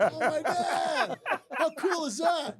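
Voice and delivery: loud, high, drawn-out elation; thrilled. Retold or imitated. Manager hearing of extra pay, thrilled. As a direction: exclaim slowly with hammed-up delight. Retold delight at bonus news.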